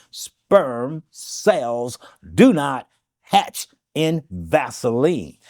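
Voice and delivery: word by word, with long gaps; very slow. Shown as a demonstration. Repeating a health claim for listeners, very slowly. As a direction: spell it out slowly, each word set apart. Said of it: "I'll say this slowly".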